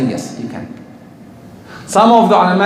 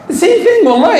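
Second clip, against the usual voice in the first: 1 heated emphasis; 12 semitones higher, faster than usual. Real, not acted. Preacher rising emphasis.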